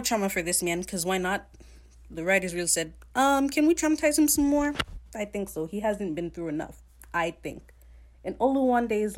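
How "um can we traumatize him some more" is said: much higher and louder, sweetly asking; high, chirpy mock question; mock innocent writer. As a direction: play the writers as faux-innocent. Mocking the writers' intentions.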